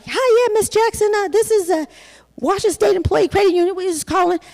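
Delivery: bank lady voice. Retold or imitated. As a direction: chirp it like a cheerful teller. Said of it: "And she's like".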